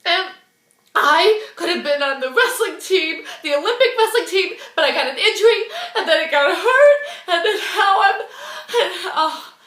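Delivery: high, hammed-up blubbering wail; mock sobbing. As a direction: imitate a grown man bawling out his woes. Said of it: "and he's sobbing".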